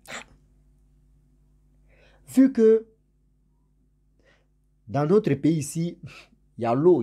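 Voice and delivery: usual speaking voice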